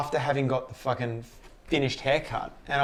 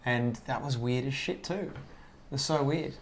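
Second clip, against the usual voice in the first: normal talking voice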